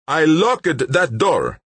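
slow, loud, high protest; angry insistence. A narrator's reading. Gunman insists to captives, slow angry protest. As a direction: hammer out each word, high and slow.

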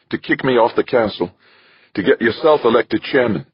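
high-pitched, bitter charge; indignant accusation. A narrator's reading. Westfall exposing Archer's scheme, indignantly accusing.

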